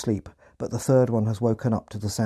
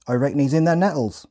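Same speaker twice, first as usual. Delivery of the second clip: higher Hampshire farmer voice; Hampshire accent; 5 semitones higher, 4 dB louder than usual. Retold or imitated. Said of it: "in a thick Hampshire accent".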